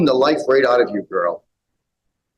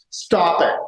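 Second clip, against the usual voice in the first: ordinary talk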